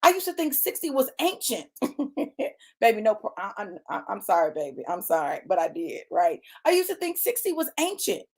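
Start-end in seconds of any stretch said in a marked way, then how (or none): none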